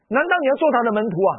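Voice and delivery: high, loud, fast defiant jab; defiant blind man; bold retort. Retold or imitated. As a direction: throw it back at them boldly. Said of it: "他回答说".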